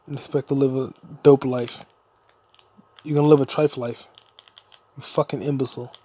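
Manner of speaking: ordinary talk, nothing marked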